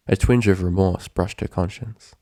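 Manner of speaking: usual speaking voice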